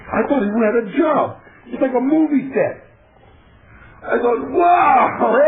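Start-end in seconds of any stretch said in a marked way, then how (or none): 4.5-5.3 a loud, high burst of wonder; amazed wow. Retold or imitated. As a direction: gasp it out like a kid on a film set. Amazed arriving at movie set.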